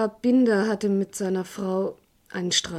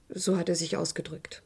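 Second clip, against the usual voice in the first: hushed; 3 semitones lower, 7 dB quieter than usual. Acted. Secret report, hushed exchange.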